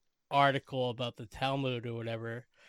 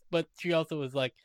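normal talking voice

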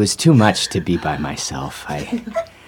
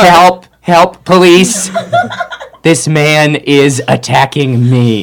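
loud, higher, slow yelling for police; mock panicked shout for help; mock cry for help; 7 semitones higher, 7 dB louder, slower than usual. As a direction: ham up a fake emergency.

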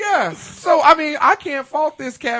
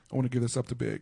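soft low dedication; 9 semitones lower, 9 dB quieter, much faster than usual. Retold or imitated. Mimics [name]'s dedication.

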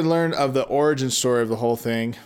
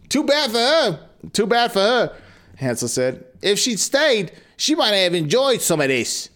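lightly voiced Brooklyn accent boy; 6 semitones higher than usual. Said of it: "say it in a Brooklyn accent".

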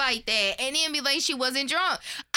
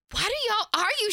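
confused question; 9 semitones higher than usual. Retold or imitated. Imitating Chrissy Teigen confused.

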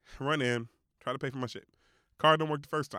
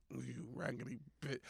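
muttered insult; 13 dB quieter than usual. Retold or imitated. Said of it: "I was like".

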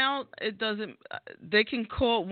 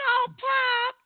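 mock gushing; 3 dB louder than usual. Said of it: "I'd be like".

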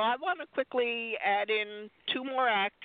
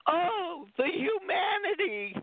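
anguished crying cry; 10 semitones higher, slower than usual. Retold or imitated. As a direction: wail it out like the horrified reporter. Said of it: "the reporter going".

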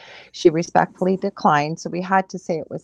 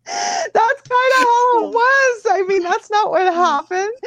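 laughing; 13 semitones higher, 6 dB louder, slower than usual. Real, not acted. Still laughing after joke.

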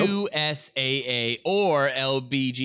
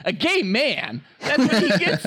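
incredulous; 7 semitones higher, 5 dB louder than usual. Retold or imitated. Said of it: "And I remember being like".

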